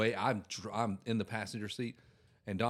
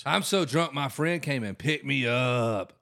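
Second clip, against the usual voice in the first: put-on loud, drawn-out drunken plea; acted drunk; 5 dB louder, slower than usual. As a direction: play drunk, dragging out the last words. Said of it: "if you get into that spot".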